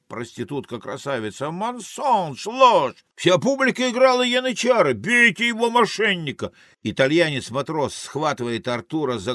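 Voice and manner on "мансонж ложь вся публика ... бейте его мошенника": high, outraged outcry; furious accusation. Acted. Beauty accusing Arthur of lying, high outraged cries. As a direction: denounce the cheat at a high pitch.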